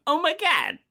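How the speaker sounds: a quick high Travolta gasp; John Travolta impression. Shown as a demonstration. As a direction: toss it off like Travolta. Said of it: "I almost went John Travolta".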